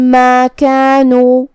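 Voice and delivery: ما کانوا with the meem merged and nasally held. Shown as a demonstration. Teacher demonstrates the merged nasal meem. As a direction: hum the joined meem with ghunna. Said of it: "ایک ہی میم پڑھیں گے، غنہ اور تشدید کے ساتھ".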